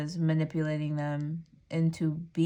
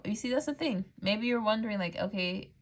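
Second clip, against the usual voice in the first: normal talking voice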